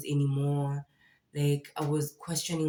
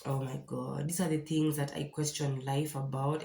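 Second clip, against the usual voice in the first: usual speaking voice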